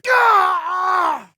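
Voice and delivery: long high-pitched scream; loud scream. Acted. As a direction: cry out as if struck. Cries out under attack.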